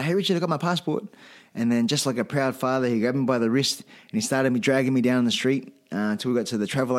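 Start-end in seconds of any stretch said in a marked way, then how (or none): 0.0-1.0 higher-pitched, eager announcement; retold excitement. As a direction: re-enact calling out good news, pitch up. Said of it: "all excited".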